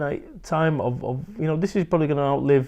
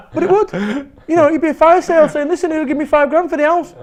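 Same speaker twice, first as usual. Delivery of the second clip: laughing; 14 semitones higher, 7 dB louder than usual. Real, not acted. Laughing at own fire-sale joke.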